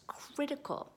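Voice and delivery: soft whispered emphasis. Real, not acted. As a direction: drop to a whisper on critical. Whispered emphasis on key word.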